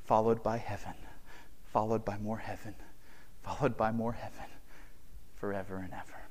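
very soft, tender, hushed; hushed closing. Acted. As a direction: speak softly to each listener. Quiet closing appeal.

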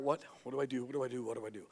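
mock worried muttering dying down; anxious fretting. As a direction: fret to yourself, fading away. Esther frets alone in palace.

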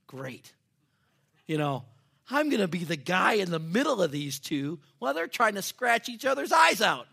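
mock-cheerful, rising into a high, loud yell; sarcastic inner panic. Youth pastor's sarcastic thought about the fight, high and shouted. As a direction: blow up the dread of being stuck between two fighters.

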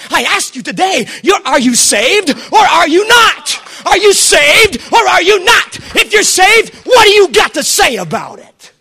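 loud slow preacher's challenge; preaching at full force. Real, not acted. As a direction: demand an answer from the pews. Altar call challenge.

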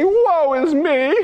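mock lament. Mock lament ending the whine.

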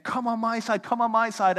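mock squabbling children; kids tugging. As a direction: call her over to your team. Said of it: "kids fighting over her".

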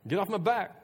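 high-pitched, testy brush-off; irritated protest. Acted. Peter to those accusing him, irritated protest. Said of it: "This time even stronger".

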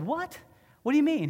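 high, shocked what do you mean; stunned what. Retold or imitated. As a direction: leap up in disbelief. Stunned reply to the stranger.

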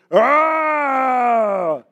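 loud roaring bear imitation. Preacher imitates the bear rearing up at Pete, loud roar. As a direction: rear up and roar like a grizzly. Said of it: "the grizzly bear stands up ... and goes".

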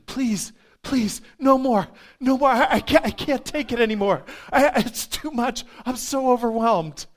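high, loud, desperate begging; overwhelmed pleading. Retold or imitated. Patient overwhelmed by prayer begs nurse to stop, loud high pleading. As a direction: beg him to stop, it's too much. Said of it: "And he says like this".

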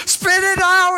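high, loud imitated yell; freaking out. Wife insisting her hesitating husband spit it out, frantic yell. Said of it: "she's, like, freaking out".

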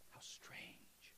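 a breathless whispered aside. Real, not acted. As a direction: drop to a whisper as if sharing a secret. Hushed aside after Jesus' claim.

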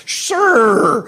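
loud, high, drawn-out mock agreement; fraternity brother's sarcastic catchphrase; sarcastic liturgy. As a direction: stretch it high with heavy sarcasm. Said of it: "always said that went like this".

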